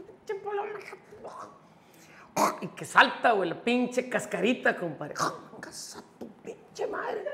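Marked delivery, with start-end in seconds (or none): 0.0-2.6 drawn-out, strangled whisper of mock frustration; imitated whispered curse. Maldice entre dientes ante su pareja. 5.6-7.2 slow, airless whispered swearing; imitated whispered curse. Maldición contenida al fallarle la cascarita.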